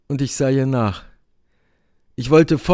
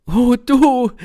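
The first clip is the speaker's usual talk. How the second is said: high, loud outburst; vehement cry; 11 semitones higher, 7 dB louder than usual. A narrator's reading. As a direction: cry out at him fiercely. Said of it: "rief sie heftig". (heftiger, hoher Ausruf)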